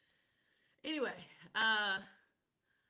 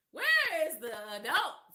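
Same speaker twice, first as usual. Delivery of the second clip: mock outrage; 4 semitones higher, 9 dB louder than usual. Real, not acted. Mock outrage at childish dating.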